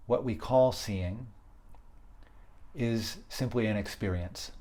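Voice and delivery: guided meditation. Acted. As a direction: guide them gently inward, leaving space between phrases.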